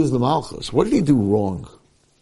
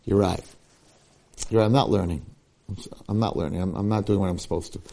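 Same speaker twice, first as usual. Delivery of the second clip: low, soft acted confession; imitated humble concession, trailing softer; subdued admission; 5 semitones lower, 6 dB quieter, faster than usual. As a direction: own up in a lowered voice. Acted student owning his fault.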